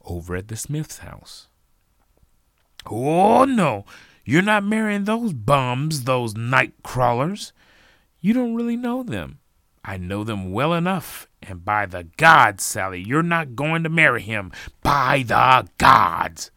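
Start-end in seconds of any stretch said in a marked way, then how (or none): 2.9-7.5 loud, high, scornful forbidding; outraged parent. A narrator's reading. Parent forbids Sally to marry Jacob, roaring. 8.2-9.4 very high, loud daughter's voice; pleading protest. A narrator's reading. Sally protests to her parent, high and loud. 9.8-16.5 loud, building to a roar; angry parental decree. A narrator's reading. Parent forbids Sally to marry, swearing angrily.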